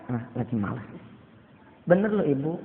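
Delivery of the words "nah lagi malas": low, soft couldn't-care-less; lazy refusal. Acted. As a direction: brush it off, too lazy to bother. Malik lazily refuses the job. (malas-malasan dengan suara pelan)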